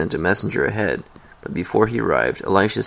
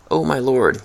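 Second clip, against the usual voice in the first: higher-pitched cry of dismay, understated; 6 semitones higher than usual. A narrator's reading. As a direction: cry out to a master in mild alarm. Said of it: "he cried out".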